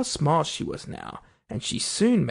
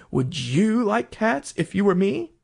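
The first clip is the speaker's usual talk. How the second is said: slow, higher indignant retort; shrill, passionate; 5 semitones higher than usual. A narrator's reading. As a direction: throw it back slowly with mounting pitch. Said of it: "in a shrill, passionate voice".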